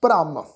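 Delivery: ਭ੍ਰਮ as one low, falling syllable with r joined on; half-r cluster. Shown as a demonstration. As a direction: run the first consonant into the r in ਭ੍ਰਮ. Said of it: "ਭੱਬੇ ਦੇ ਪੈਰ ਵਿੱਚ ਅੱਧਾ ਰਾਰਾ". (ਭ੍ਰਮ, ਅੱਧਾ ਰਾਰਾ ਜੋੜ ਕੇ)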